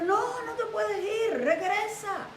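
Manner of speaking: high, drawn-out pleading; desperate plea. Retold or imitated. Saúl stops Samuel from leaving, desperate plea. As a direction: act out a king begging him to come back.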